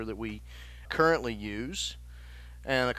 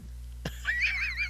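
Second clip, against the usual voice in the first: burst of laughter; 5 dB quieter than usual. Real, not acted. Listener cracks up.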